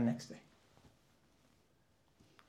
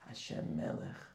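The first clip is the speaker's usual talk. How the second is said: whispered; 9 semitones lower, 7 dB quieter than usual. Shown as a demonstration. Quoting the words of prayer.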